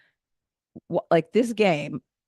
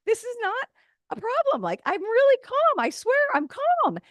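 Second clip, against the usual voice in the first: squeaky, very high fake-sweet voice, raised way up from her own; much higher voice. Comic talking to the cop, voice pushed very high. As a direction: push your voice way up high to sound harmless. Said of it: "So let me just make it higher".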